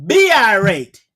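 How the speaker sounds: loud, slow defiance; defiant slow shout. Real, not acted. Preacher taunting the devil, loud and slow. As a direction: hurl it at the devil, slowly.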